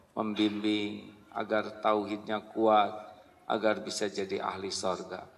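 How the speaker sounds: hushed, earnest husband's prayer; soft humble prayer. Acted. Husband praying softly for his wife's guidance. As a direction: pray it low and humbly.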